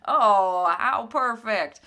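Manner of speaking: loud, slow, thrilled; delighted. Real, not acted. Host thrilled there are still spaces left, loud. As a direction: savour the good news out loud.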